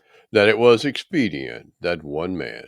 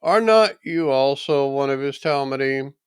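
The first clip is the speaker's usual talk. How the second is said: higher, louder pointed question; accusing bystanders; 9 semitones higher, 6 dB louder than usual. A narrator's reading. Men at the fire challenge Kepha, pointed and accusing. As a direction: press him, sure he's one of them. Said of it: "They said therefore unto him".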